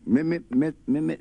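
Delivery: high, loud stuck on "Mi-"; boy stutters "Mi-" trying to say Mister; stuttering. Acted. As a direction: stammer on "Mi-" unable to get the word out. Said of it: "he was aiming to say".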